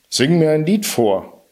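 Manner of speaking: a lightly voiced giant's command. A narrator's reading. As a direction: order the harp to sing, gruff. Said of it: "befahl er der Harfe".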